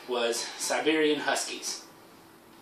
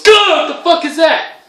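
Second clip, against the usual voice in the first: much higher, louder, startled yelp; shocked outburst; 12 dB louder than usual. Retold or imitated. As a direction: shriek it like you just saw a monster. Owner shocked at fake dog.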